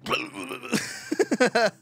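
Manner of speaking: high spluttering shake noise; imitated chair rumble. Comic showing theater chairs shaking as a bomb goes off, imitated rumble. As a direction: mimic wildly rattling seats with a burbling sound.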